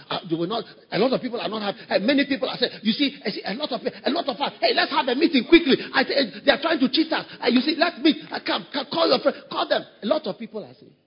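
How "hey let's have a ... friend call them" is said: a touch of an eager conspiratorial voice; rally your friends like a schemer; mock urgent plotting; scheming whisperers. Mimics scheming church politicians.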